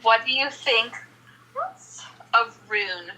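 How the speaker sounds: ordinary talk, nothing marked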